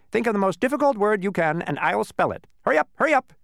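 high, loud impatient urging; impatient bee. A narrator's reading. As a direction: hurry him along.

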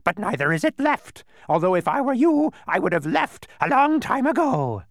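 high, loud bird screech; shrill-pitched, scolding bird character. A narrator's reading. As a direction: squawk out a scolding at full volume. Narrator voices the quibbling bird.

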